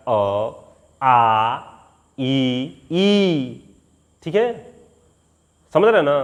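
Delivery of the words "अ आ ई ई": आ and ई stretched with the mouth wide open; proper vowel recitation. Shown as a demonstration. A teacher demonstrates the vowel pattern. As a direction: pronounce each vowel fully and separately. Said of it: "उसका pattern है बोलने का".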